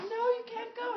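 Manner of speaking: high-pitched, imitated objection; mock pleading protest. Jack tries to stop the dreamer leaving, high mock pleading.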